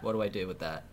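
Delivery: ordinary delivery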